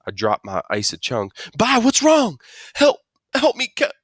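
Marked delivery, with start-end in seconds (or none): 1.6-2.4 high, loud alarmed cry; alarmed kid. Acted. Narrator voices alarmed child calling out. 2.7-3.9 high, loud muffled cry for help; panicked plea. Acted. Stuck Byron begs for help.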